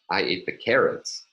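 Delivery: carrots hit harder, meaning that and nothing else; contrastive stress on carrots. Shown as a demonstration. Linguistics lecturer showing one thing is meant over another, stress on carrots. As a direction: lean on the word carrots. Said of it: "if I say".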